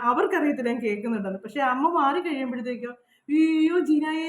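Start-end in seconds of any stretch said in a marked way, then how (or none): 3.3-4.2 sugary, higher mock-loving call of her name; fake affection. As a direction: imitate a neighbour putting on sweetness. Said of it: "എന്നെ സ്നേഹം കാണിക്കുന്നവർ".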